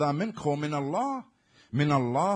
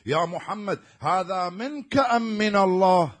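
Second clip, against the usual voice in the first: insolent challenge; 4 semitones higher, 7 dB louder, slower than usual. Retold or imitated. Insolent man challenges the Prophet.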